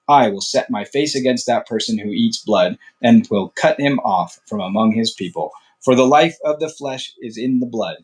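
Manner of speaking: measured, formal Bible reading voice; scripture reading. Acted. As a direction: read the law as holy text, steady and solemn. Reads scripture aloud, formal.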